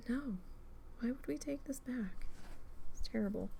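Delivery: half under the breath; muttered retort. Retold or imitated. Clerk grumbling to coworkers about the returned kit, softly.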